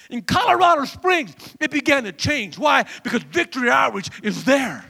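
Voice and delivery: loud climax of a sermon; fired-up preaching. Acted. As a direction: drive it home to the congregation. Preaches at full force.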